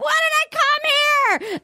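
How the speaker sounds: high, whiny mock sob; mock crying. Retelling Jasenia crying on the show, mock whiny wail. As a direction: wail it like a hammed-up crybaby. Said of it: "Justenia starts crying".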